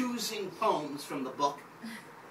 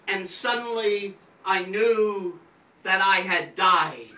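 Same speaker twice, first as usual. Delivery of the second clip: louder, deliberate poetry recital with weighty pauses; poem reading; 4 semitones higher, 6 dB louder, slower than usual. Acted. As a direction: perform it to the room like a stage poet, slowing on key lines. Reads his poem aloud.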